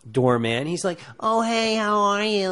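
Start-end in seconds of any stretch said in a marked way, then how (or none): 1.2-2.5 much higher, cartoon chipmunk-like greeting; imitating a quirky classmate, squeaky and cartoonish; quirky cartoonish guy. Imitating J R's audition as the doorman, high and cartoonish. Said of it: "like that".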